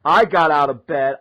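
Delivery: plain reading voice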